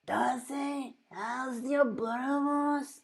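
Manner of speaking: high slow put-on voice; Nathaniel impression. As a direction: raise the pitch and draw it out. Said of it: "I used to be able to do Nathaniel".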